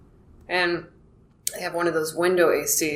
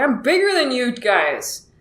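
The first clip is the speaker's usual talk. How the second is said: mild mock yell, pitched up; higher-pitched imitation of a holler; retold yell; 6 semitones higher than usual. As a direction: re-enact the protest without full volume. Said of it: "I just yelled it".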